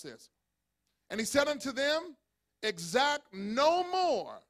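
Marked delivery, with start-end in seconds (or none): 2.6-4.4 each word stretched for the congregation; slow, drawn-out quote. Shown as a demonstration. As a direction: read it out slowly and deliberately.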